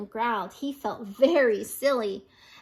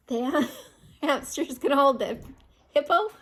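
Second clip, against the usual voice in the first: giggling through the words; can't keep a straight face; real laughter. Real, not acted. Reader laughing at hamster holding hippo, giggling through it. Reader cracks up at own story.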